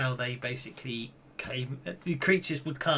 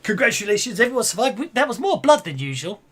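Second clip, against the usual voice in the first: excited; 6 semitones higher, 8 dB louder than usual. Real, not acted. GM celebrates party's survival.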